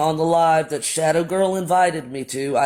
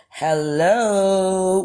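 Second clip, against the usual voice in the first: drawn-out hello; 3 semitones higher than usual. Retold or imitated. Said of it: "with, like, four O's".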